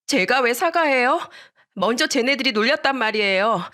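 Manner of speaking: high-pitched defiant protest; indignant child. Acted. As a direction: argue back like a wronged kid.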